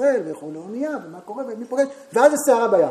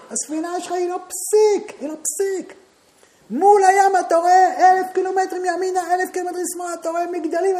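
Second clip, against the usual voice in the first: high, loud, intense preaching; preaching at full force; 10 semitones higher, 9 dB louder than usual. Acted. The rabbi tells his audience how small man is before the sea, preaching at full force. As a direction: hammer home how helpless you are at sea.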